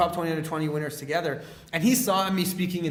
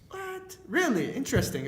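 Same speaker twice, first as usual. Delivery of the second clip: mock disbelief; 12 semitones higher than usual. Recalling his surprise that ordinary people speak, mock disbelief. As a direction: act out a high, incredulous double take. Retelling his mindset shift.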